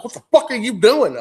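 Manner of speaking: high, loud startled shout; loud wife yelling at her husband over the noise. Retold or imitated. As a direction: yell at him like she was just woken up.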